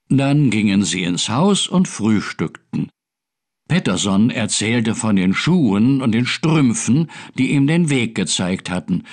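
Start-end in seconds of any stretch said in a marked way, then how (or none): none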